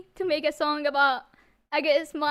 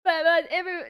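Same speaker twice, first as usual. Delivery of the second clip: tearful; 2 semitones higher, faster than usual. Real, not acted. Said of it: "I will start crying more".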